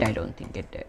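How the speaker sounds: a near-silent whisper, trailing off; whispered aside. Real, not acted. Streamer whispering a doubtful aside to chat, hushed. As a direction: drop to a breathy whisper for the aside.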